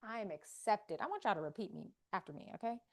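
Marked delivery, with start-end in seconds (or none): 0.0-1.0 soft affirmation. Acted. First affirmation.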